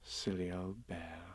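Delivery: soft, slow, tender; loving. A narrator's reading. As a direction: say it very gently and fondly. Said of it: "in such a loving voice".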